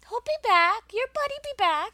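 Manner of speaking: high, sweet pet-talk voice. Real, not acted. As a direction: coo to the dog. Talking sweetly to the dog.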